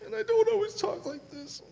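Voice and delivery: choked up and tearful, pushing the words out; crying. Real, not acted. Father pleading with the school board, crying. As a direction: voice breaking into high sobs as he speaks. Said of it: "I'm sorry that I'm that guy crying".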